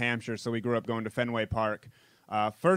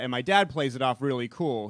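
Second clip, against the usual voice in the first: ordinary talk, nothing marked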